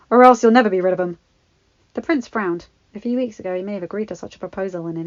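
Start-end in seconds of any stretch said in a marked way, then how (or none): 0.1-1.2 fast, loud and fierce. Acted. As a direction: push it hard and quick. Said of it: "another man said fiercely".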